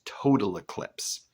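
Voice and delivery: "total eclipse" slowed, TO- leaning heavily; stress on TO-. Shown as a demonstration. Teacher demonstrates the trochee, "total eclipse" with strong first syllable of "total". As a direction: punch the first syllable of "total", then fall through "eclipse". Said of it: "a stressed first syllable and unstressed second syllable".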